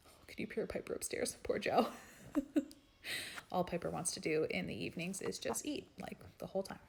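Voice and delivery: half-whispered aside about the dog; hushed aside. Real, not acted. To viewers about Piper upstairs, hushed. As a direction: drop to a hush, as if not to disturb.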